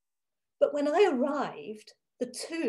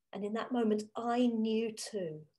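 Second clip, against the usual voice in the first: usual speaking voice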